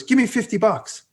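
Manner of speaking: high, cheeky demand; retold demand. Young kid to apologizing woman, retold demand. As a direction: demand cash in an imitated kid's voice.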